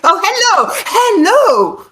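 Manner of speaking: high, swooping hellos; playful hello. Real, not acted. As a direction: sing out a sudden high greeting. Playful second greeting after finishing intro.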